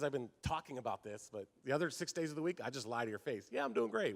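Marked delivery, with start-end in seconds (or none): 3.5-4.2 fake cheerful reply.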